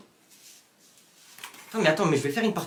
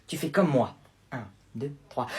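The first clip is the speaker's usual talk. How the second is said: ordinary talk, nothing marked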